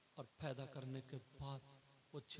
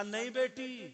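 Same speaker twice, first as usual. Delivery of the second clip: high loud cry of a pleading mother; a mother calling after her daughter, high and loud; mother's plea; 9 semitones higher, 12 dB louder, slower than usual. Retold or imitated. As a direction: call out to stop your daughter.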